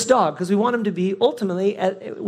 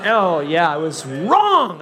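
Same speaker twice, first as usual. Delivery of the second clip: ordinary talk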